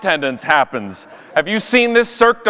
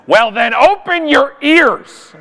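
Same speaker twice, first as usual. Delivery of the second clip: higher, slow, drawn-out comeback; imitated snippy retort; 5 semitones higher, 3 dB louder, slower than usual. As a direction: mimic a rude flight attendant, stretching the words. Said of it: "looks me right in the eye and says".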